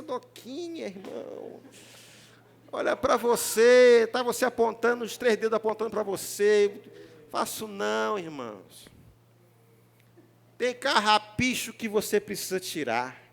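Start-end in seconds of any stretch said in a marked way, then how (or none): none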